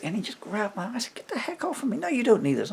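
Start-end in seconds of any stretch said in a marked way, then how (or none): 1.1-2.7 high, loud shout of protest; retold shout. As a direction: yell it at the man grabbing you. Fighting off attacker with scissors.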